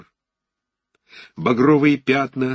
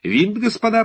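higher, brash showman voice; showy ringmaster voice; 13 semitones higher, 5 dB louder than usual. Acted. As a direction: sell it like a showman. Talman proposes cards, high showy voice.

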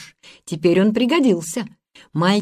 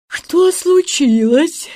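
high, slow drowsy question; sleepy; 9 semitones higher, 4 dB louder, much slower than usual. A narrator's reading. Jacob asks without opening his eyes, sleepy. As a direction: ask slowly, still half asleep. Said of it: "спросил Якоб, не открывая глаз".

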